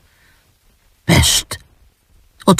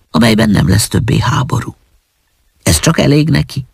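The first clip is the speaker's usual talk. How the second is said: plain reading voice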